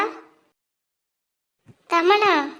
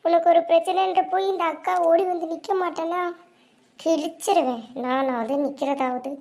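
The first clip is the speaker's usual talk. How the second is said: ordinary talk, nothing marked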